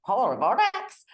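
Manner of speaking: a bad American accent on "Polar vortex"; mock American accent. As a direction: try the American "polar vortex" with strong r's. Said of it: "Neither of us can do an American accent".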